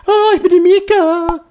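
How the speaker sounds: high, loud, put-on girl's voice; a playful high-pitched girl impression; girly voice. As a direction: squeak it up like a young girl. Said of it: "weibliche Betonung reinzukriegen".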